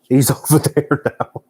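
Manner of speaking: amused surprise, breaking into a laugh. Real, not acted. Amused at dog moving under blanket.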